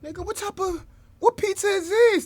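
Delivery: high-pitched incredulous question; baffled disbelief. Real, not acted. Reacting to officers' to-go pizza, baffled squeal.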